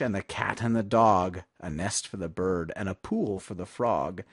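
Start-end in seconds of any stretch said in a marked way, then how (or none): none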